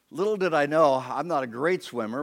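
ordinary delivery

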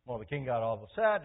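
usual speaking voice

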